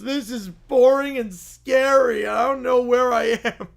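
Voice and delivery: put-on high, loud, whining child on the edge of a meltdown; whiny kid tantrum. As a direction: sulk out loud like nobody cares about you. Bear cub tantrum.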